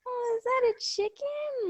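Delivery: imitating Kylie's clueless coo; ditzy high voice. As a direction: play the clueless girl, way up high. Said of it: "and she goes".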